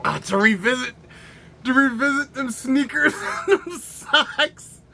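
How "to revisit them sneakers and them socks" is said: words rising into high laughter; breaking into laughter. Real, not acted. Reviewer revisiting sneakers and socks, dissolving into laughter. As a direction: start steady, then crack up completely.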